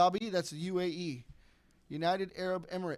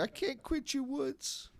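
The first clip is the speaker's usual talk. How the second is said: mock movie quote; 8 semitones higher, 5 dB quieter, much slower than usual. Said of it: "He said, he said it earlier".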